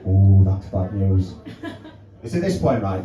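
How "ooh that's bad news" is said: low, slow, ominous "ooh, that's bad news"; grave vet. Vet breaking bad news on the phone, low and slow. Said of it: "She says".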